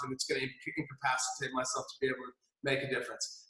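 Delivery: very soft low aside; subdued. Real, not acted. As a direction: fade into heavy point. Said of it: "dropping low".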